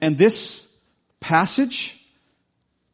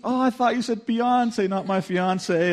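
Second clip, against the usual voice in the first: realizing surprise; 9 semitones higher than usual. Retold or imitated. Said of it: "he goes".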